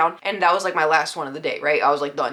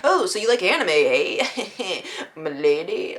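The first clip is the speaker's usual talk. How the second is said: hammed-up sleazy chuckle, slowing down; mock creepy flirt; slower than usual.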